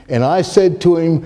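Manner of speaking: usual speaking voice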